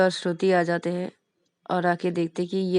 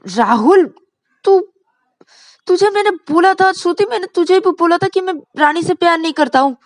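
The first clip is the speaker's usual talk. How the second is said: high, loud frustrated shout; furious complaint; 10 semitones higher, 9 dB louder than usual. Acted. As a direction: vent at friends after the slap. (गुस्से में चिल्लाते हुए)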